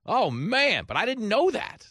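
high, loud wail of disbelief; exaggerated naive shock; mock astonishment. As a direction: cry out as if stunned, hammed up. Acts out own naive astonishment.

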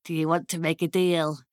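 low imp voice; low, sinister little deal-maker; bargaining imp. Acted. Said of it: "the imp looked at her".

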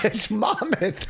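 "Monmouth" said laughing as "MON-mit"; laughing correction. Shown as a demonstration. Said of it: "You're so excited".

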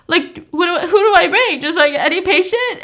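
high-pitched mock bewilderment; imitated helpless, high questioning; acted flustered question. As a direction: play him flustered and squeaking upward. Flustered man asks in retold scene.

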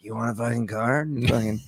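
low, hushed imitation of a pusher; mock card pusher. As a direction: softly mimic a shady sales offer. Imitating campus credit card pushers.

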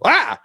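high, loud tiny yelp; squeaky startled cry; little startled yelp. Acted. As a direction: yelp like a tiny creature caught off guard. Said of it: "you hear a little voice go".

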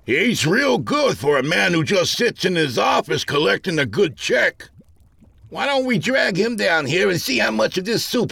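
put-on high-pitched old-timer's angry rant; old-timer crying out. A narrator's reading. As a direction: holler it high, rallying the other workers. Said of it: "an old-timer cried".

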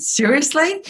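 high-pitched, disbelieving question; can't believe what you just heard; incredulous retort. Retold or imitated. Woman answering her gloomy partner's complaint, incredulous.

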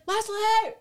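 high-pitched imitation Jamaican accent; mock Jamaican accent. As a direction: call the name in a playful Jamaican accent. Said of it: "With his Jamaican accent".

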